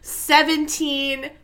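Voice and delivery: slow, drawn-out dramatic intro; dramatic narrator. Retold or imitated. Elders set the scene in a slow dramatic storyteller voice. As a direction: announce the setting like an old storyteller. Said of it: "They're like, Picture it".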